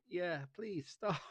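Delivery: high, slow, laughing plea; mock pleading throat. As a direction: drag it out, begging.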